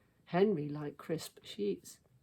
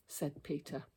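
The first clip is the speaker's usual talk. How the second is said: plain reading voice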